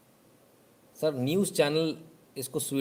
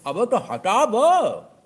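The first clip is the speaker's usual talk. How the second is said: much higher, louder, very slow yell; know-it-all yelling at shopkeeper to erase it; mock shout; 10 semitones higher, 9 dB louder, much slower than usual. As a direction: stretch the order into a scornful yell. Customer ordering word removed.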